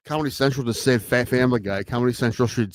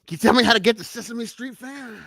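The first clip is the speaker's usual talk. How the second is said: high sing-song voice; 11 semitones higher than usual. Acted. Sesame Street song parody.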